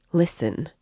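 plain reading voice

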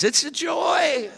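high, slow, mock-cheery; tongue-in-cheek sigh of relief; sarcastic. Real, not acted. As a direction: say it with a wink. Sarcastic joke on grown kids.

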